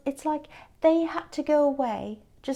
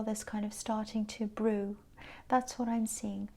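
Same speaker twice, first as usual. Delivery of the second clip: soft whispered conclusion; hushed sign-off to the vision; 3 semitones lower, 5 dB quieter than usual. Real, not acted. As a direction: whisper it with quiet certainty. Tarot reader closing a vision.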